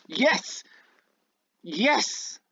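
excited cheer. Real, not acted. Celebrating a lucky roll.

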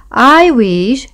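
slow, high, thoughtful start; said slowly. A narrator's reading. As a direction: begin the wish slowly, lingering on it. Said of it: "he said slowly".

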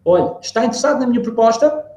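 acted caller seeking an answer; salesperson asking. Shown as a demonstration. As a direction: pitch the offer brightly as a question. Acting a caller pitching a proposal.

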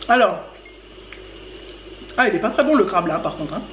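talking while chewing crab; speaking between bites; mouth full. Real, not acted. Seen 0: Woman at right holds crab piece near mouth, apparently chewing, while speaking.

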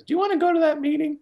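high, quick mock question from Joe; high-pitched impression of a friend's offhand question; imitating Joe. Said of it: "Joe looks at me and goes".

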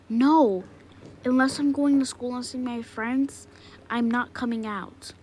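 loud stubborn shout; defiant refusal. Acted. Child refusing to leave the room, loud and defiant. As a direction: dig in and yell the refusal.

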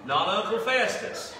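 mock-wrong "Uncle Festus", loud and slow; joking contrast. Shown as a demonstration. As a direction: play up "Festus" as the wrong word for a laugh. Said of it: "Down here we say fester".

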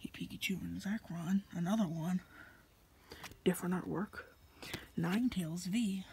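hushed secret whisper. Real, not acted. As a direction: whisper so he can't overhear. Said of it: "Yes, I'm whispering 'cause he can probably hear".

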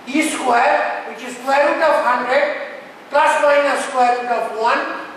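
usual speaking voice

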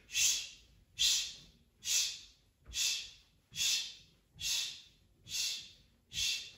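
rhythmic shushing on each stamp; short shh in time with marching feet; shh sounds. Shown as a demonstration. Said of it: "we're gonna do a shh as we stamp our feet".

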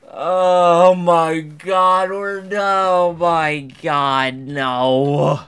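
long, drawn-out wailed moan; dismayed wail. Real, not acted. Wailing over a game event.

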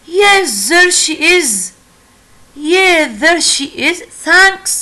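bright, high and happy; thrilled to find mom, lifting up; happy relieved girl; very happily. Acted. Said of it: "The girl answered very happily".

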